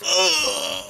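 loud, high groan of revulsion; disgusted groan. Real, not acted. Player watching a gory mutilation, disgusted groan. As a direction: recoil with a loud "ugh".

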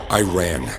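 plain reading voice

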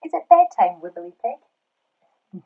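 calling to the pig. Acted. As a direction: call out brightly as if to a child, then ease down. Asks the pig in the story.

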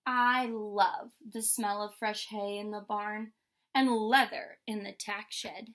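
usual speaking voice

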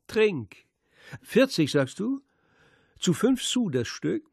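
high, loud sneering coaxing; mocking taunt. A narrator's reading. Radek goads the poor old newspaper woman, a jeering high taunt. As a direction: toy with her, jeering.

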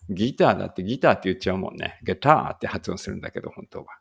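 ordinary delivery

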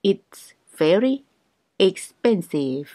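very slow, each syllable clear; slow teaching pace; slow drill. Shown as a demonstration. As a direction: spell it out slowly for learners.

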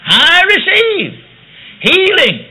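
loud, high and very slow proclamation. Retold or imitated. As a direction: proclaim it loud, stretching each word. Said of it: "out loud".

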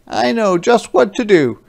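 Jakey cheerfully takes over. Acted. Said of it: "said Jakey".

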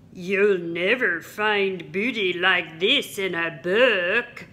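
pirate bragging to Henry; boastful pirate. Acted. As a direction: brag like a pirate showing off loot. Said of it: "told him".

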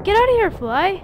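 high loud shout at a fly. Real, not acted. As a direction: yell to shoo it away. Shooing fly.

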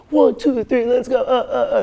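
high, loud mock hype-man shouts; mock rapper. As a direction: parody a rapper hyping up the stage. Mocking boring rappers' stage shows.

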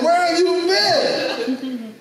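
high, slow laughing question; laughing wake-up. Retold or imitated. Waking pilot asking the preacher, high and laughing. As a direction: laugh through the line in disbelief.

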